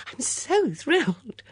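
high, slow, breathy rapture; swooning excitement; breathed delight. A narrator's reading. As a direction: draw it out, thrilled. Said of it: "breathed the female voice".

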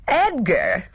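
high, scornful exclamation with a laugh. A narrator's reading. Gina mocking Edgar to Miss Marple, scornful laugh. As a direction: toss the name off with a cruel little laugh. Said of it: "note of cruelty in Gina's scornful laugh".

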